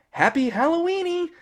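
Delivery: very high sitcom sing-out; Kathryn Hahn. Retold or imitated. Agnes driving off from Vision, hammed-up sitcom farewell. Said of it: "tropey Kathryn Hahn voice that she has".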